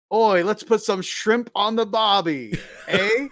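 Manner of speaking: slow, laughing mock Australian with a Canadian eh; slow laughing mock accent mixing Australian and Canadian; mixed-up Aussie-Canadian. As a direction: ham up a cartoon Wolverine's botched accent. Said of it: "their introduction was".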